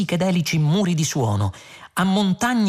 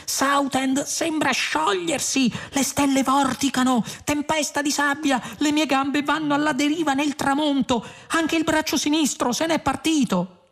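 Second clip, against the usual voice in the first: high, panicky calling out; alarmed cry; 11 semitones higher than usual. A narrator's reading. Arthur watching Southend melt and his limbs drift off, alarmed. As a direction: wail each observation at high pitch. Arthur cries out as world melts.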